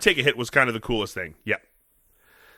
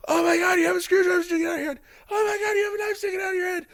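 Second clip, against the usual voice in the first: very high, rapid panicked squealing; hammed-up hysteria building into a scream; mock freak-out. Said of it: "they're freaking out".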